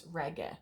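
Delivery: reggae with a short final e, "REG-eh"; Latin ablative pronunciation. Shown as a demonstration. Teacher corrects back to the Latin form, reggae said "REG-eh". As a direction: say reggae softly as "REG-eh", no glide at the end. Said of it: "not reggae. It's not Jamaican music".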